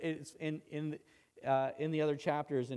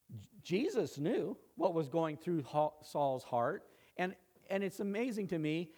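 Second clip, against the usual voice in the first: usual speaking voice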